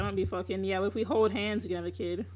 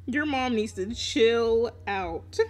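slow, deliberate, with no laugh; drawn-out emphasis; much slower than usual. Real, not acted. Telling a friend about his mom, slow and emphatic. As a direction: stretch out "chill out" slowly. Repeats the line after laughing.

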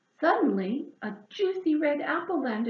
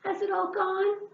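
high, hopeful question; 9 semitones higher than usual. Acted. Hedgehog asking if the load is gone, hopeful high. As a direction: ask it full of hope. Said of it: "she asked hopefully".

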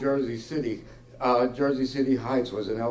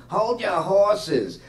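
higher, slow, mild mock yell; 8 semitones higher, much slower than usual. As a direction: call out at the ringing phone, drawn out. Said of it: "she yelled at the phone".

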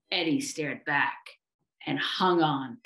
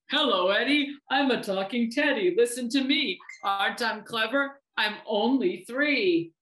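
lilting, higher-pitched toy voice; pretend talking teddy; 5 semitones higher than usual. Bear hides behind his teddy, playing talking toy. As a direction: put on a singsong teddy voice.

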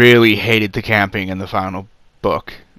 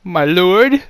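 much higher, louder whimpered "My lord"; pathetic Lucius; 9 semitones higher, 5 dB louder than usual. Retold or imitated. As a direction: grovel as pathetically as you can. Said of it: "in the most pathetic voice he could manage".

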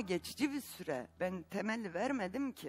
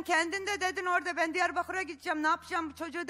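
heated yelling; 8 semitones higher than usual. Real, not acted. Heated argument, quoting her.

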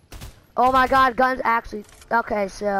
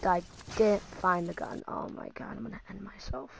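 very soft, deflated mutter; defeated mutter; 2 semitones lower, 11 dB quieter than usual. Real, not acted. Gamer who hasn't found the gun, defeated mutter. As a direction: mumble it under the breath in defeat.

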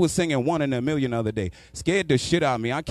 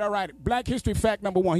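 higher-pitched put-on uncle voice; uncle impression; 8 semitones higher than usual. Uncle announces a history fact, mock high voice.

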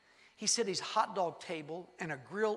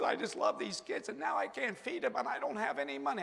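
higher, choked near-breathless crying voice; imitating bawling; 7 semitones higher, 5 dB louder than usual. Preacher mimicking Chili Bean crying over the ruined hot dogs. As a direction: mimic a man sobbing out his worry.

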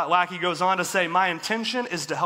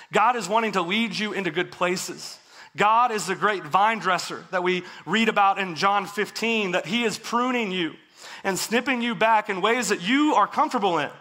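normal talking voice

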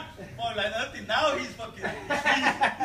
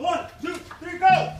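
shouted count-off; 6 semitones higher, 9 dB louder, much slower than usual. Real, not acted. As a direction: count climbing to a loud go. Count-off for pushing a beam.

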